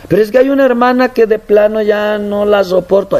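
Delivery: high mock whine; mock the complainer; whiny complaint. Member complains of a sister. (queja quejumbrosa, aguda)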